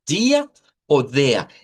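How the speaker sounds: ordinary talk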